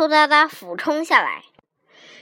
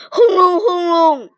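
high, forceful earthquake rumble; vocal thunder, pushed hard; imitated rumbling; 5 semitones higher, 4 dB louder than usual. A narrator's reading. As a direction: boom out the rumbling quake. Earthquake noises voiced in story.

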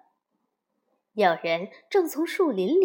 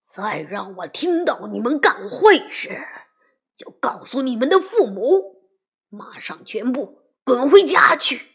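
louder and louder furious yell; bellowing; 7 dB louder than usual. A narrator's reading. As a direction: roar the warning at the bad kids. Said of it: "他转身冲那帮坏小子大吼着". (冲坏小子越吼越响)

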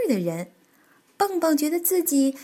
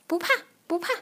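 soothing; 6 semitones higher, much faster than usual. Acted. Tiaotiao comforting scared Bengbeng after a nightmare, soothing and gentle. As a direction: hush a frightened friend. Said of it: "跳跳安慰他".

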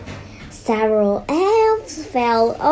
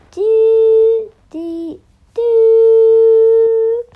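loud, high sung doom notes; doom music; 10 semitones higher, 10 dB louder, much slower than usual. Shown as a demonstration. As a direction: belt out a menacing music sting. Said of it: "doom-laden music".

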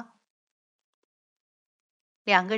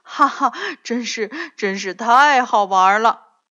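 loud, breathless laughing words; gasping after the snowball fight; panting with laughter; out of breath; 2 semitones higher, 10 dB louder than usual. A narrator's reading. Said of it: "上气不接下气地说".

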